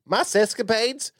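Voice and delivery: high, slow Southern drawl as Jerry Jones; Jerry Jones accent. Pretend. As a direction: ham up a Jerry Jones Arkansas twang. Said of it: "I guess you're doing Jerry Jones".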